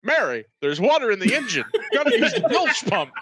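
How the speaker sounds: quick, drawn-from-old-movies impression; Jimmy Stewart. Actor fixing flooded engine calls to Mary, movie-star impression. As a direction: imitate an old film star calling his wife. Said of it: "he turns into Jimmy Stewart".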